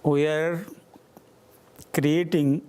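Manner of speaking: ordinary delivery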